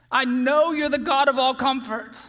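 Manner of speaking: loud, pleading prayer from someone in distress; anguished plea to God. Acted. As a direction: cry it out to God as a troubled believer. Voices a struggling believer's prayer.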